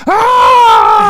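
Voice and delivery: loud, very high-pitched mock scream; imitated scream. Fan acting out the faceless guy at Willie's car window, screaming. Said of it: "and just screams".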